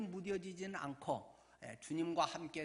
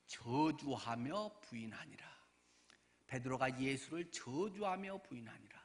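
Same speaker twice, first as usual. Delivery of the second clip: ordinary talk, nothing marked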